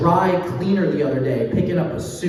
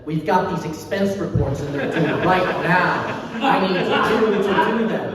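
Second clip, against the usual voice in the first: boss voice. Retold or imitated.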